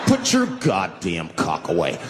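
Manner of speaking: low, slow, stern order; suddenly brave passenger. Acted. Newly brave passenger to the man on the bus, low stern command. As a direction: draw it out low, laying down the law. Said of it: "now they're brave".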